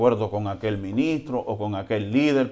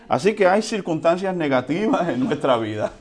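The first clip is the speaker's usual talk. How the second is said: ordinary talk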